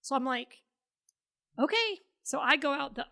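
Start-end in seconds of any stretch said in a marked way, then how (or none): 1.6-2.0 high, rising uncertain okay; retold unsure reply, pitched high; hesitant agreement. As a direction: squeak out a tentative okay. Retold meek reply, caught out.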